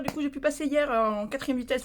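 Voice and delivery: ordinary delivery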